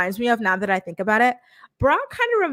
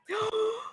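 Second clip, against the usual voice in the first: sharp, higher-pitched gasp of excitement; excited gasp; 12 semitones higher, 7 dB quieter than usual. Real, not acted. Host sees co-host arrive, excited gasp.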